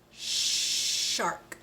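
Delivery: shark with a long unvoiced sh; careful shark. Shown as a demonstration. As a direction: stretch the sh in shark.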